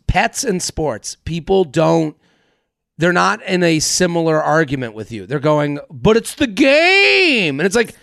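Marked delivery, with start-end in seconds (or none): 5.9-7.6 high, drawn-out fan whine; mock protest. Sports fan excusing himself to an annoyed neighbor, mock whining. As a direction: stretch out the last word in mock pleading.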